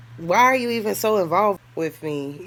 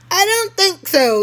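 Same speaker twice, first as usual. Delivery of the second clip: high, slow, louder scoff; scoffing disbelief; 13 semitones higher, 7 dB louder than usual. Real, not acted. Woman scoffing that the FBI hunts a prostitute. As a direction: scoff at the idea, drawn out.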